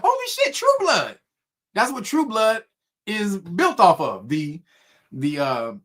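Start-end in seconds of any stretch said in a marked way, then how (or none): 0.0-1.2 much higher excited exclamation; light up like it all just clicked; high excited mock gasp; excited realization. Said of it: "And I was like".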